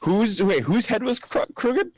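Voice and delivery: high, loud startled question; jolted out of the story, confused; surprised. Real, not acted. Player startled by detail.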